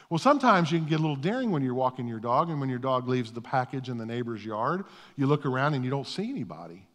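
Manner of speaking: normal talking voice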